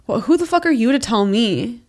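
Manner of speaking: ordinary talk, nothing marked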